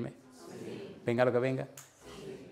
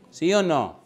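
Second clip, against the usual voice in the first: pressing louder; 3 semitones higher, 6 dB louder, slower than usual. Acted. Presses the congregation again.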